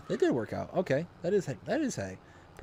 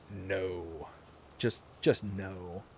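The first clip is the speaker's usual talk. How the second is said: low, soft, slow refusal; deadpan, weary dismissal; firm disapproval; 6 semitones lower, 7 dB quieter, much slower than usual. Real, not acted. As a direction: drop low and shut it down quietly. Flat rejection of the sequels.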